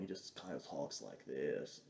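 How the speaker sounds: slow, low, quiet stoner impression; stoned Jake Busey. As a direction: imitate an actor baked off his ass. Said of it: "he's stoned off his ass".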